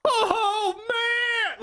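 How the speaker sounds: high, wailing cry of joy; mock ecstatic squeal, near tears; overexcited train fan. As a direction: gush like you're overcome by a train. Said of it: "got excited about that train".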